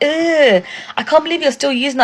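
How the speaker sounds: high-pitched imitation of disgusted staff; mock public disgust. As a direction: groan "ugh", then shame them in a high voice. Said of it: "they'll say it out loud".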